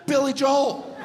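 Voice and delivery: "Billy Joel" one syllable, loud and high; the right pronunciation. Shown as a demonstration. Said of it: "who pronounces it".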